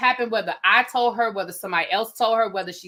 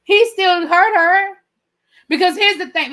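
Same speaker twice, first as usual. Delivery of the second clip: emphatic insistence; 7 semitones higher, 8 dB louder than usual. Real, not acted. Insisting against the other's argument.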